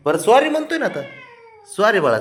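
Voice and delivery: pleading apology. Real, not acted. Father apologising to sulking child. (उंच, लाडीक सुरात माफीची विनवणी)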